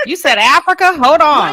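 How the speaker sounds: high, loud stunned question; scandalized cohost; disbelief. Real, not acted. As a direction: shriek it in shocked delight. Cohost reacts to Africa remark.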